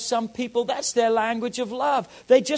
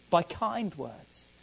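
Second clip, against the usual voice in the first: kindly; 3 semitones lower, 7 dB quieter, much slower than usual. Shown as a demonstration. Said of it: "saying words kindly".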